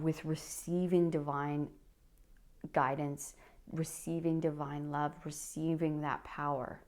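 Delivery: ordinary talk, nothing marked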